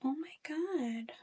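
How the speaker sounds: stunned, breathy whisper, slow; shocked whisper. Real, not acted. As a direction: barely breathe it out in disbelief. Reacting to high screen time.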